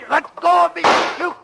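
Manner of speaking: high, loud yell while wrestling free; struggle shout. Acted. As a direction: shout as you fight off his grip. Struggling with the bartender.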